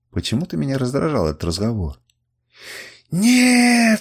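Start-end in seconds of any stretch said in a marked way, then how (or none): 3.1-4.0 long, high, loud scream; piercing cry of refusal; screamed denial. A narrator's reading. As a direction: scream it out, stretching the vowel. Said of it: "вскрикнула она".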